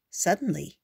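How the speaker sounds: usual speaking voice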